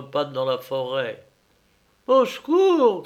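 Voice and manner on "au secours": high, loud panicked cry; cry for help. Acted. Coachman fleeing into the forest, panicked high yell. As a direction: shout for help in terror. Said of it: "cria-t-il".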